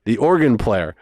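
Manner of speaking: normal talking voice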